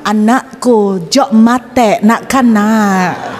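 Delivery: slow, lightly voiced Madurese accent. Preacher imitates a Madurese neighbour, slow light Madurese accent. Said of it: "Kalau misalnya orang Madura yang ngomong".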